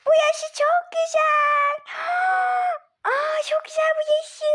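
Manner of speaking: put-on child thrilled, shouting with joy; excited gasp. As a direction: gasp and squeal with delight at the toys. Child delighted by play room.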